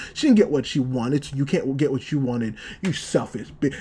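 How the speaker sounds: low, insistent inner voice; lightly voiced accusing inner self, kept low; haunting past self. Past self haunting Aqua over not resting, low and accusing. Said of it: "kid past self haunting him".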